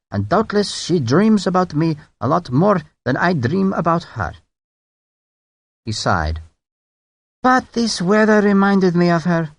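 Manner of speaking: silky and courteous, a touch Scottish; mild courteous brigadier. Acted. The French brigadier charming Sharp with memories of Edinburgh, mild and courteous. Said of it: "even charmingly".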